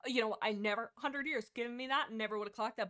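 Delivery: usual speaking voice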